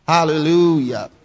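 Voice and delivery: ordinary talk, nothing marked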